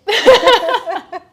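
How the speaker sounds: very loud, high burst of laughter; real loud, delighted laugh; big laugh. Real, not acted. Laughs after thanking host.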